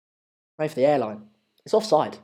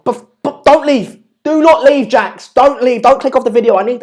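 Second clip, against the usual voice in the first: pleading; 5 semitones higher, 5 dB louder than usual. Real, not acted. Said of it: "begging viewers not to leave".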